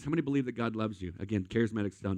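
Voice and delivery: normal talking voice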